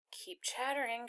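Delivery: unvoiced inner whisper; whispered to herself. A narrator's reading. Anne-Marie reminding herself, in a whisper. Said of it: "she told herself".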